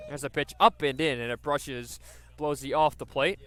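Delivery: normal talking voice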